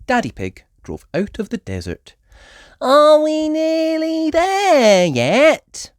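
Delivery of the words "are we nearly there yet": put-on high, slow, drawn-out child's whine; whiny Peppa. A narrator's reading. Peppa asking her dad on the long drive, slow whining. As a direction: whine from the back seat. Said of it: "asked Peppa".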